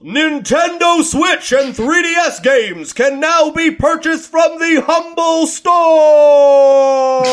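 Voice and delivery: booming slow hype announcer. Shown as a demonstration. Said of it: "I have a decent announcer voice".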